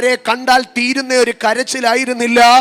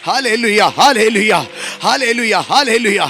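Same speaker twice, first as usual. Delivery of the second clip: fervent hallelujah; 2 semitones lower, 3 dB louder than usual. Real, not acted. Worship exclamations.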